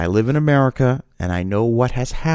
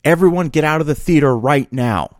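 higher, slightly louder retold yell; mock yell; 6 semitones higher, 4 dB louder than usual. Somebody orders everyone out of theater, moderately raised mock yell. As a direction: imitate someone yelling an urgent order, restrained. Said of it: "somebody yelled out".

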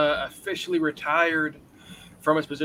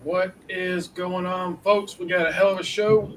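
hype show opener; 3 semitones higher, 3 dB louder than usual. Acted. Cohost opens with a hype greeting.

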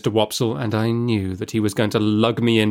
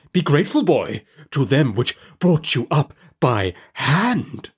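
higher, slow preachy uncle; pompous uncle; 4 semitones higher than usual. A narrator's reading. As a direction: lecture the boy, drawn out and pompous. Pumblechook lectures the boy.

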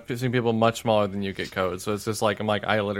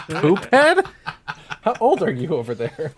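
high and louder, laughing through the words; laughing disbelief; 5 semitones higher, 5 dB louder than usual. Real, not acted. Friend teases Chris about poop head, laughing. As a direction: crack up while teasing him. Teasing a friend's childish word.

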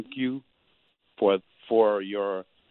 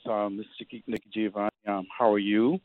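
ordinary delivery

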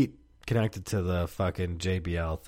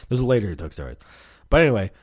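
normal talking voice

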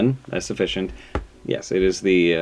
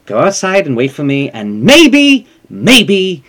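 stern mother; 6 semitones higher, 10 dB louder than usual. Retold or imitated.

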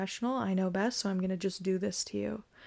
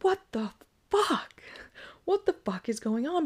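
imagined shock; 7 semitones higher than usual. Retold or imitated. Imagined reaction to a stranger.

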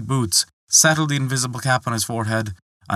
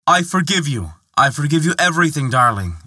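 higher, louder, rushing burst of joy; joyful exclamation; 6 semitones higher, 4 dB louder than usual. Acted. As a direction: blurt out forgiveness, overjoyed and fast. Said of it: "exclaimed the prince".

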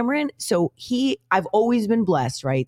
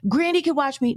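put-on whiny kid; 9 semitones higher than usual. Mom imitates pleading son.

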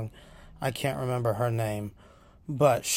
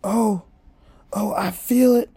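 high, loud, hammed-up cry for forgiveness; frantic repenting; 9 semitones higher, 5 dB louder than usual. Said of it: "all of a sudden he goes".

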